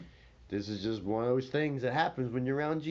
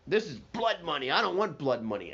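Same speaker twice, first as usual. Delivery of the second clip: higher, louder outburst; a mock-angry Judas, higher and louder; indignant refusal; 7 semitones higher, 5 dB louder than usual. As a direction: shove the blood money back, raised voice.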